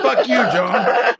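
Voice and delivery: slow and high, laughing through the words; laughing insult. Real, not acted. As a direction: swear at him while cracking up.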